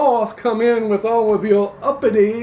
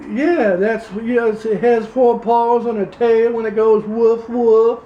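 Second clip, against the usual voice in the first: put-on Louisiana accent. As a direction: play a pompous Louisiana candidate, Southern accent. Same character continues.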